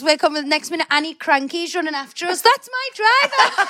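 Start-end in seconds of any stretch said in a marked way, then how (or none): 2.4-3.7 high, stretched-out calling voice; mock shout. Retelling the driver running after us, mock shout. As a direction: call it out high, drawing the words out.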